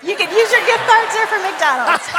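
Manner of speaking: high, loud words through laughter; laughing. Real, not acted. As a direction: laugh while you answer. Joking about McDonald's in Hawaii.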